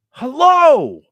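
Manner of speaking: sudden high furious yell of hello; retold angry scream. Dad yelling hello into a phone on the table. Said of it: "he screams out".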